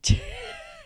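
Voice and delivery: short high-pitched laugh. Real, not acted. Reader cracks up at boots tightened beyond recognition, high laugh. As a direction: burst out laughing.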